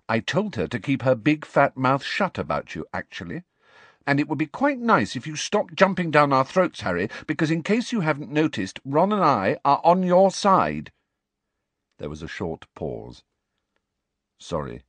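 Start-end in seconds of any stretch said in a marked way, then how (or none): 0.1-10.9 high, rising exasperation, slowing at end; a lightly voiced, fed-up schoolgirl; exasperated Hermione. Hermione scolds Harry. 14.4-14.8 low, soft apology. A narrator's reading. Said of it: "said Harry in a low voice".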